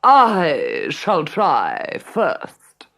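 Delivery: slow, breathy, grand stepmother; haughty stepmother. A narrator's reading. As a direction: claim it with lofty airs. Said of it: "boomed their mother".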